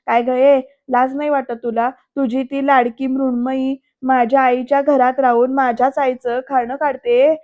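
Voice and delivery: usual speaking voice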